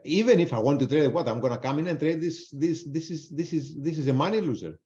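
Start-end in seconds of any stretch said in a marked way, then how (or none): none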